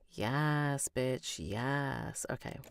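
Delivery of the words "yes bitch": ordinary delivery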